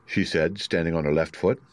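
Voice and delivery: usual speaking voice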